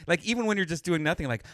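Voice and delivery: normal talking voice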